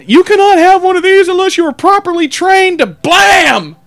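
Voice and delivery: high, loud hammed-up safety lecture ending in a bang; mock lecture shout. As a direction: yell it like a preachy instructor. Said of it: "that cop quote continues".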